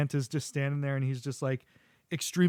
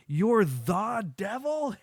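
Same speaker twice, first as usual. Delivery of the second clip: higher, drawn-out disbelief; incredulous; 7 semitones higher, much slower than usual. Acted. Santa realizing who he is talking to, incredulous. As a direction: stretch it out in mock astonishment. Santa finds devil on naughty list.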